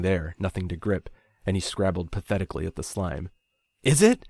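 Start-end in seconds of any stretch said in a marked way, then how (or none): 3.8-4.3 loud, high, desperate shout; angry desperate shout. A narrator's reading. Ryan, desperate to stop Nico climbing, shouts angrily. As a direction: hurl the question back at him. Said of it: "I shouted, angry, desperate".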